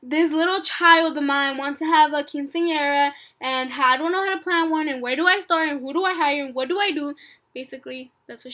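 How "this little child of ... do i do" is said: loud, raised replay of the panicked rant; frantic mom translated. Retold or imitated. Translating mom's phone rant to viewers, loud and frantic. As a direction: keep the mom's loud, panicked energy in English. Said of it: "basically she said".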